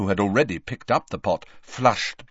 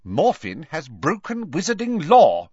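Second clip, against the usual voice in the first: stern, higher-pitched official pronouncement; 6 semitones higher, 5 dB louder than usual. A narrator's reading. Official telling a father of his son's crime, sternly. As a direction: state it firmly and a little louder. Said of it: "said Ogden sternly".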